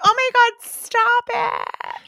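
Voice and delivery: put-on very high, loud girly squeal of disgust; squealing girl. Girl mocking the boys' fast food idea, shrieking high. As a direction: shriek it like a grossed-out teen girl. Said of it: "the girl's like".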